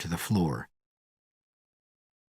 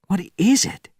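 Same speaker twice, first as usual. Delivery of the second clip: put-on young Soviet alarmed; 9 semitones higher than usual. Young Soviet alarmed at distant light.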